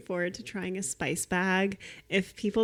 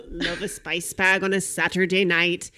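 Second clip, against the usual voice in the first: put-on sharp, clipped Irish accent, a little louder; sharper Irish accent; 6 dB louder than usual. S0 retries the Irish reviewer, sharper Irish accent. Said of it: "it's, like, sharper".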